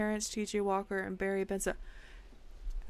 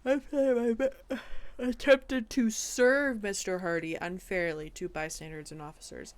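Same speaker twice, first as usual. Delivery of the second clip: ordinary talk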